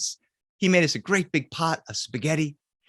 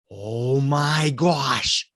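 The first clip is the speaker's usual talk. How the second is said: very slow, hushed oh my gosh; drawn-out awe; much slower than usual. Retold or imitated. Uncle staring into window, slow awed gasp. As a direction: stretch it out in dread.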